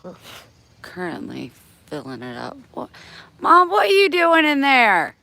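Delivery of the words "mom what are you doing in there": high, loud yell to mom; shouting. Real, not acted. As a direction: yell across the yard. Yelling to mom in pool.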